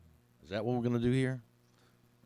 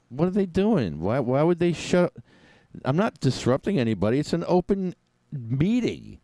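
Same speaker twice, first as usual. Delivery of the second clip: higher, pushed, aggrieved complaint; indignant protest; 7 semitones higher, 4 dB louder than usual. Real, not acted. Muted at an open meeting, indignant protest. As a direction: protest the unfairness with rising pitch.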